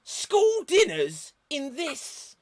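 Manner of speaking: high, slow, pompous schoolboy voice; Billy Bunter voice. Acted. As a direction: huff like an indignant posh schoolboy. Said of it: "I am Billy Bunter".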